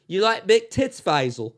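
brash Cockney woman, hammed up; Cockney accent. British wife coming on to Faisal, hammed-up Cockney. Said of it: "it gets a little more cockney".

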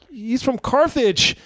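high-pitched, incredulous retort; comic incredulous outburst; exasperated comeback. Acted. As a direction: protest it high in disbelief.

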